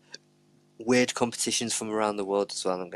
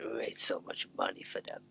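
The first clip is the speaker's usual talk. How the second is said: hushed whispered echo; 5 dB quieter than usual. Acted. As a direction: breathe it out as a whisper. Echoes line in a whisper.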